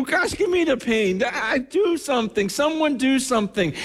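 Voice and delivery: high-pitched, urgent retold begging; desperate plea. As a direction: plead helplessly for someone else's pain to stop. Acts out helpless anguished plea.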